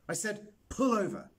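insist, hitting pull hard; insistent repeat. Acted.

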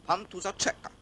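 high, snippy imitation of a housewife; mimicking the wife. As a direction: imitate the wife brushing him off. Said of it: "otwiera żona".

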